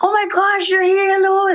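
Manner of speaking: high, excited retold gush from the old boss; old boss greets her warmly, high and gushing; gushing greeting. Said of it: "Tapos sabi na".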